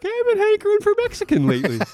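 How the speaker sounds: high, fast, put-on voice of a cheerful client; Jimmy Link impression. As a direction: jump way up and rattle it off like an eager client. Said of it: "Jimmy Link has been like".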